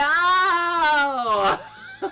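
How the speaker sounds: high, loud shriek of laughter; laughing scream. Real, not acted. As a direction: cry out laughing at defeat. Dies again, laughing scream.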